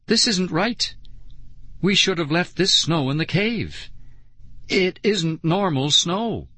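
uneasy, deliberate warning; quiet concern. A narrator's reading. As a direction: state it gravely, slowing at the end. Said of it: "Nicole said quietly".

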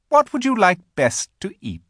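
high, loud, inviting question; coaxing queen. A narrator's reading. The Queen tempts Edmund with food, coaxing. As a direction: offer it grandly in a queenly voice.

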